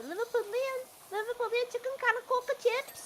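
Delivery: high-pitched Scouse impression; squeaky-high put-on Scouse accent. Shown as a demonstration. Host showing co-host the high-pitched voice, mock Scouse accent. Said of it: "I can do, like, the Scouse".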